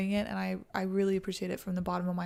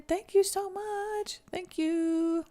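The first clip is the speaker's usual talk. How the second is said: high, slow, drawn-out thank you; sing-song thanks; 11 semitones higher, 3 dB louder, much slower than usual. Real, not acted. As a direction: beam it out like a cheerful sing-song.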